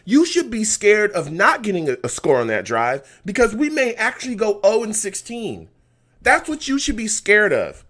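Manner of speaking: loud, high, furious fan yelling at the coach; angry rant. Real, not acted. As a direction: let it rip at the coach. Fan raging at coach's play call.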